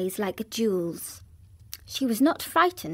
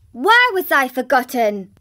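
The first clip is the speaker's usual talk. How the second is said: put-on much louder, indignant child's outcry; foot-stamping anger; 3 semitones higher, 9 dB louder than usual. Mary to the officers, stamping her foot, loud angry demand. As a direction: demand it like a furious little girl. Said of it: "stamping her foot".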